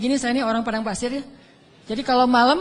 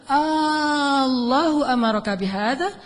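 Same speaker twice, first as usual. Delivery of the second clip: slow recited Arabic; much slower than usual. Acted. Hajar's Arabic question, chanted slowly.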